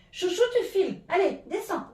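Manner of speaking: high, loud shoo to a pet; shooing the cat. Real, not acted. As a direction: chase the cat off firmly.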